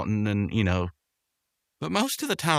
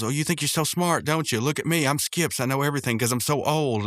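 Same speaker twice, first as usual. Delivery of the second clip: higher-pitched mocking rant, acted out; Rigby ranting mockingly; 6 semitones higher than usual. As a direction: imitate a sarcastic outburst, pitched up. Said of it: "this sets Rigby off again".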